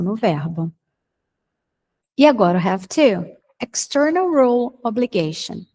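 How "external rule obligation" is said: normal talking voice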